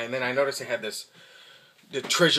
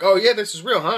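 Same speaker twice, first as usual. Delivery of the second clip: higher and louder, mock-impressed; sarcastic doubt; 8 semitones higher, 8 dB louder than usual. Teasing the store clerk about his fake gear, mock-impressed. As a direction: fake being impressed by junk.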